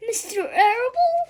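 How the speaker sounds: high, loud shocked cry; horrified cry. A narrator's reading. Wilbur learning who'll kill him, a shocked cry. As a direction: cry out in alarm.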